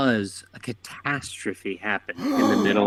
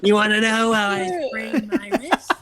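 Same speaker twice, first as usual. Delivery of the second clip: high laughing Joker line; 9 semitones higher, 4 dB louder than usual. Acted. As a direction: tease it like the Joker. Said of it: "Joker, too".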